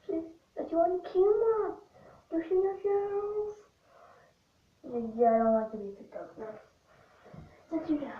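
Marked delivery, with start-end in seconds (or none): 0.1-3.6 pet talk. Real, not acted. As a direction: coo at the pet in a high voice. Talking to the cat, high pitched.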